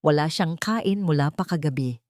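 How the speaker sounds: usual speaking voice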